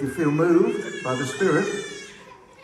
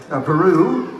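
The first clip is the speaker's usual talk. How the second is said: high, raised roll-call voice; 8 semitones higher than usual. Real, not acted. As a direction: call each out for a response. Roll call of countries to crowd.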